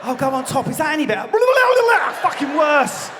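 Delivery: high-pitched question into mock shout; acted exasperation. Couple failing to stop belly gurgles, exasperated. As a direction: build to an exasperated high yell.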